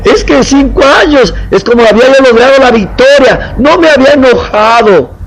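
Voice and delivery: hammed-up self-pitying plea; whiny complaint. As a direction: whine to God that the victory was lost. Believer complains to the Lord.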